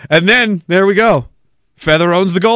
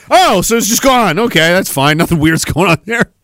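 normal talking voice

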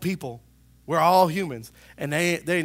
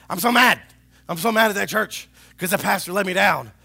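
louder, higher mock rant about the pastor; hammed-up upset member; mock anger; 4 semitones higher, 10 dB louder than usual. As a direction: ham up a churchgoer's pouting fury. Imitating upset churchgoer.

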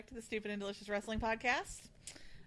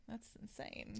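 whisper; 11 dB quieter, slower than usual. Real, not acted. Mock shock at Monday drinking.

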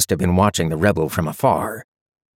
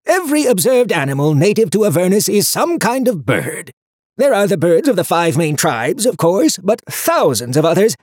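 high, loud pedantic book voice; fussy; 10 semitones higher, 3 dB louder than usual. A narrator's reading. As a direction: lecture like a prissy know-it-all. Harriman the book lectures.

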